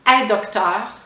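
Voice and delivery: "est" as open "è" before "docteur"; no l, just "è docteur"; pronoun dropped. Shown as a demonstration. Teacher showing Quebec "elle est", reduced pronunciation. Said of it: "On va même pas prononcer".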